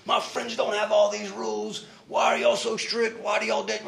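put-on high complaining teenage voice; lightly mocking a complaining son; whiny kid. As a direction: pitch up and whine about the rules. Son complaining about rules.

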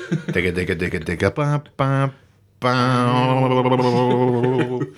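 chanted patter into a long held wail; Banshee impression. Showing off for the co-host, a Banshee impression. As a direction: imitate a siren-like Banshee, holding the last note. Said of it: "That was my Banshee impression".

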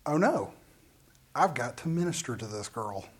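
low, soft aside to oneself; dropped voice, retelling a sinking thought; hushed realization. Retold or imitated. Preacher realizes the grieving girl needs help, hushed and low. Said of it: "And I went".

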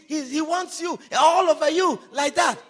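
ordinary talk, nothing marked

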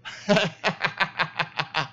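giggling fit that fades; helpless laugh. Real, not acted. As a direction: dissolve into laughter. Laughing at flubbed line.

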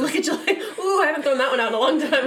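laughing. Real, not acted. Co-host revives an old catchphrase with friend, laughing. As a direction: rattle it off through laughter.

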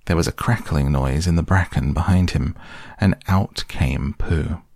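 ordinary delivery

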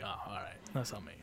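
low, very soft acted apology; small, embarrassed murmur; sheepish retreat. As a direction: back down quietly, under your breath. Retelling his sheepish reply to teacher.

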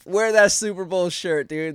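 loud, high, stretched-out cheer; excited shout. Real, not acted. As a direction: holler it slowly at the players.

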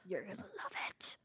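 soft whispered tease. Real, not acted. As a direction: whisper it playfully. Whispered aside.

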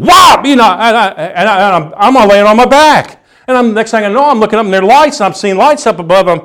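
high, worked-up retelling; excited blow-by-blow. Acted. Preacher telling congregation how Tyson floors him, excited. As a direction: act out the knockdown, pitch jumping up. Acts out being knocked flat.

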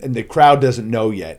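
usual speaking voice

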